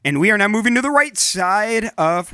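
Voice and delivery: announcer voice. Acted. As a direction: boom it out like a game-show host. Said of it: "why am I talking that voice?".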